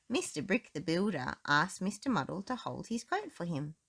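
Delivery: ordinary delivery, nothing marked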